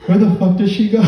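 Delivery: high, baffled cop asking where she went; confused cop. Retold or imitated. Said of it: "He's like".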